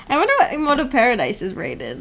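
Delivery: usual speaking voice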